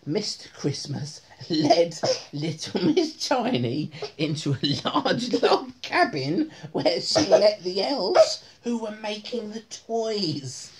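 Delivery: plain reading voice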